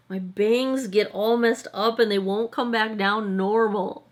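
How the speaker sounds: ordinary talk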